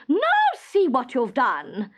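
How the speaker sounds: an exasperated queen crying out; cried out. A narrator's reading. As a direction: blame him outright. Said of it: "cried the queen".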